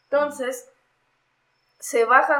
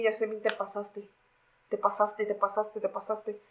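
retold stunned muttering; 7 dB quieter, slower than usual. Victim reacting to policeman cocking his gun, quietly stunned. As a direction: repeat it softly, as if to yourself.

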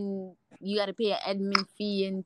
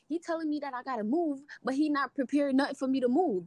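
higher, fast, exasperated complaint to mom; retold complaining; 5 semitones higher, 5 dB louder, faster than usual. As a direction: vent it like you're fed up on the phone. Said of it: "complaining, cussing, like, getting mad".

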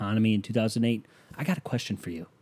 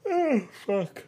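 high-pitched words through a yawn; 10 semitones higher than usual. Real, not acted. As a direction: let a yawn swallow the words. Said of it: "Sorry, you shouldn't yawn".

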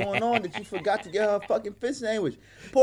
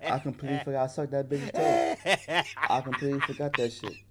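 laughing through the words, pitched lower; laughing recollection; 4 semitones lower than usual. Real, not acted. Man reminded by friend of back-seat embarrassment, laughing. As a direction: crack up at your own embarrassing memory.

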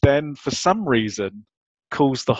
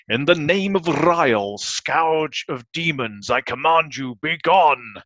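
grand, commanding spell casting; dramatic incantation; 4 semitones higher, slower than usual. Retold or imitated. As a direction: intone it like a wizard's command. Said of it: "I will try and deliver them in the way that fake Stan Lee delivered them".

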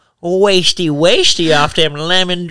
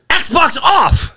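mock shout of a voice command; 12 semitones higher than usual. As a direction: bark it at the console. Yelling at console in game.

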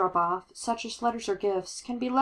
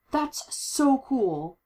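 awed; 6 semitones higher, much slower than usual. Acted. Awed reaction in story.

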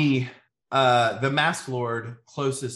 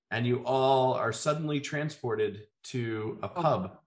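ordinary talk

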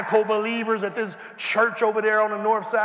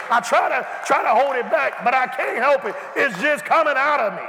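high, loud fervent preaching; can't hold it in, let it pour; preaching at full force; 3 semitones higher, 4 dB louder than usual. Acted. Preacher telling the congregation he can't hold it in, shouting.

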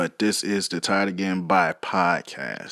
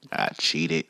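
ordinary talk

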